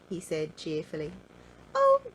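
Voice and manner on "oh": very high, loud happy squeal; high, loud cry of glee; delighted exclaim. Acted. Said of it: "smiled little Miss Greedy".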